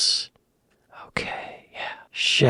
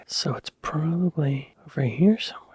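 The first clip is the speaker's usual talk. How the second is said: ordinary talk, nothing marked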